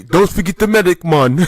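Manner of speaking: high, loud put-on Jamaican voice; Jamaican accent. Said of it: "She has a suck ass Jamaican accent".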